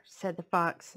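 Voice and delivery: plain reading voice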